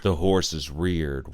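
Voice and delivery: ordinary delivery, nothing marked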